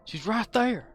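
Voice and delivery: put-on drunk dad voice.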